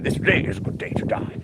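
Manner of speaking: unvoiced-sounding rasp of a Klingon; Worf. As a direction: rasp it out as a Klingon war motto. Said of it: "You love it when Worf says that".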